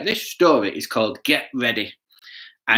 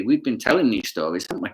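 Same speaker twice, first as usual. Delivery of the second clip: ordinary delivery, nothing marked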